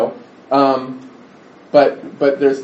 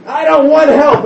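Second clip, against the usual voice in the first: loud, high-pitched angry shout; defiant shout, pitched way up; angry child; 12 semitones higher, 8 dB louder, slower than usual. Retold or imitated. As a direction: yell it out in a child's furious refusal. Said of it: "she says to me angrily".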